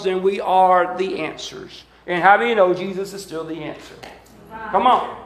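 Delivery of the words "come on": ordinary talk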